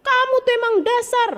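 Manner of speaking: quick, high mock scolding without volume; high, fast, imitated rant; mock furious mother. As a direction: imitate an exasperated mother telling off her child. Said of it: "Dia marah setengah mati".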